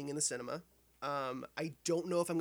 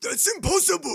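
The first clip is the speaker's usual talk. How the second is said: high, loud hammed-up movie line; mock movie shout; 12 semitones higher, 12 dB louder than usual. Friends quoting a film, mock shout. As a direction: yell it like a doomed officer.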